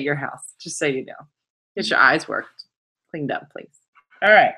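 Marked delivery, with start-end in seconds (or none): none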